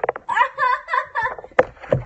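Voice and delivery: high, loud laughing fit; loud burst of laughter. Real, not acted. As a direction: crack up loudly. Phone just died.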